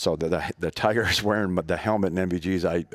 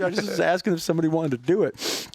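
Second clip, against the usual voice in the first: laughing protest; 11 semitones higher than usual. Real, not acted.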